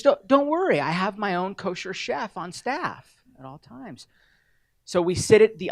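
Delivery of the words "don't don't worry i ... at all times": high-pitched imitation of a reassuring host. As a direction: burst in high and loud, then ease off. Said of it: "He saw that I was nervous".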